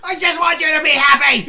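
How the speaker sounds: loud, high shouted mom voice; mock mom shout. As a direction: yell it like an overbearing mom.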